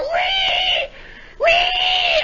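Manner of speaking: loud, piercing imitated whine; long high wire squeal. Imitating the chute catching the wind, high squeal. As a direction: squeal like a runaway winch wire. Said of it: "this wire is going out".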